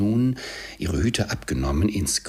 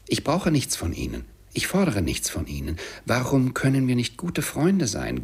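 put-on higher, animated young relative protesting; pleading nephew; 7 semitones higher than usual. A narrator's reading. Nephew challenges his uncle's excuse, pleading. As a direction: reason with him urgently.

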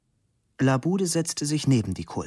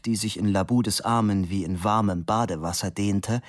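usual speaking voice

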